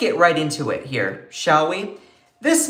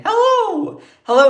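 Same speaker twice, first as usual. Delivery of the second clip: much higher, laughing hello; excited greeting; 6 dB louder than usual. Real, not acted. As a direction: squeal a delighted hello. Greeting viewers joining.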